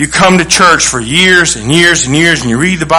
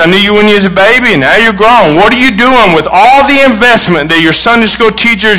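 ordinary talk

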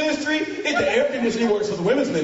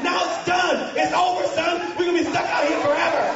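scolding rant; 5 semitones higher than usual. Retold or imitated. Teen scolding the youth pastor about the bus. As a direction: tear into the new pastor. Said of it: "Walked up to him and said".

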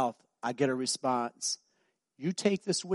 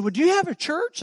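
eager stranger; 8 dB louder than usual. Retold or imitated. Stranger voiced in a story.

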